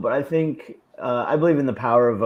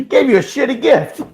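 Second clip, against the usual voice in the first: laughing; 10 semitones higher, 7 dB louder than usual. Real, not acted. Laughing at her own joke.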